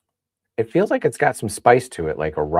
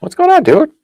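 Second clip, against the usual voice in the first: excited greeting; 10 dB louder than usual. Real, not acted. Greeting a chat regular arriving.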